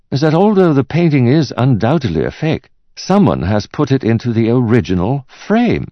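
high-pitched picture appraiser; puzzled art expert. Acted. Expert's lines voiced in narration.

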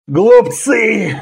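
high, strained moaned outcry; hoarse groan. A narrator's reading. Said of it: "хрипло простонал варвар".

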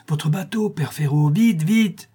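high, hurried call for the boat; urgent cry. A narrator's reading. La Louve calls to old fisherman, high and urgent. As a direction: she cries out for the boat, urgent. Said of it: "la Louve s'écria".

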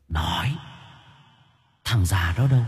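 hushed, threatening interrogation; menacing growl. A narrator's reading. Ghost interrogating her captive, menacing hushed growl. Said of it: "Tiểu Yến nghiêng người gằn giọng".

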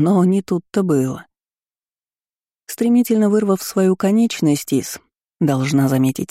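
ordinary delivery

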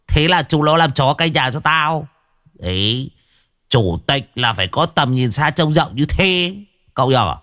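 a lightly voiced old man, full of himself; boastful old man. Old man bragging to the young master, swaggering voice.

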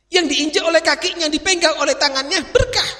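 high, loud preaching climax; soaring sermon cry on berkah; preaching at full force. Acted. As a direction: build and shout it up to berkah.

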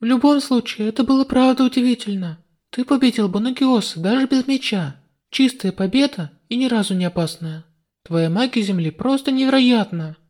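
put-on young heroine, relieved; girl's voice. Heroine praising hero. (звонкий девичий голос)

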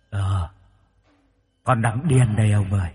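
lightly eerie voice from beyond; a faint echo from the underworld; a hint of a ghostly wife calling. A narrator's reading. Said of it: "giọng không giống như giọng bình thường".

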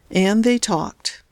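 ordinary delivery, nothing marked

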